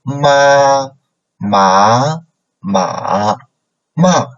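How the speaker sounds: ma said in first, second, third and fourth tone; walk through the tones on ma, level, rising, dipping, falling. Shown as a demonstration. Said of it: "So here we got".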